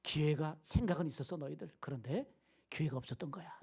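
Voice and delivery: low, soft, half-whispered reassurance; warm understanding. Acted. Paul excusing the Philippians' absence, warm and hushed understanding. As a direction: forgive them gently, fading to a whisper. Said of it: "따뜻한 마음".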